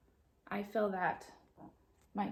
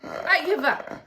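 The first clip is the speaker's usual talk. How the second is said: excited Amelia; 8 semitones higher, 8 dB louder, slower than usual. Acted. Amelia excited at librarian greeting.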